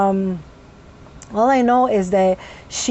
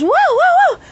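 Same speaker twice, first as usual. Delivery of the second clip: high, loud repeated yelps; involuntary yelped bursts; vocal tic whoas; 5 dB louder than usual. Real, not acted. Tics interrupting her as she relives it, high yelps. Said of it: "it's starting to happen again".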